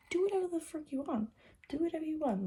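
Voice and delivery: ordinary talk, nothing marked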